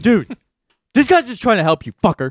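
high, loud "Dude!" telling him off; mock scolding shout. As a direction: snap at the rude customer, half joking. Acted scolding of grumpy customer.

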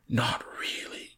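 hushed, slow gasp of disbelief. A narrator's reading. Mister Saltina hearing of the Prince of Wales, gasped. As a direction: whisper it in astonishment. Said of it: "gasped Mister Saltina".

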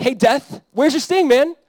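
high, loud casual taunt; mocking, belittling. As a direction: jeer at death as a beaten rival. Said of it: "he's, like, mocking or belittling death".

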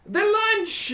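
very high leader's call; kobold boss. Acted. Kobold boss calls to his crowd, high proclamation. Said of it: "and he says".

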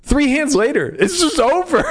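high disbelieving shout; exasperated high shout. Real, not acted. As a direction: yell it out in disbelief. Exasperated shout about match ending.

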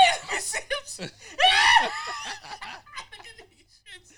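loud high laughing. Real, not acted. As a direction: shriek it through laughter. Hysterical laughter.

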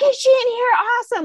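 high, loud thrilled squeal; excited high fangirl squeal; mock excitement. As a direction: gush like a delighted fan. Excited at seeing her again.

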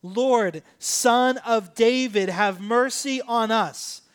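slow, drawn-out loud call. Retold or imitated. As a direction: call it out emphatically, stretching each word. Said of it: "They said it even louder".

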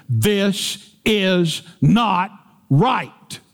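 "this is not right" drawn out slow, leaning on "not"; emphatic reading. Shown as a demonstration. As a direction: stretch "not" hard, then land "right". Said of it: "This is how emphatic it is".

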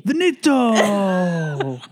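slow, high, drawn-out echo; a playful imitation of the finale; mock finale. Host echoing Grace's story, slow mock cry. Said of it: "and so she just went".

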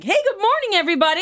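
high, chirpy greeting; bubbly overeager captain; perky pilot. Retold or imitated. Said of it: "they're like".

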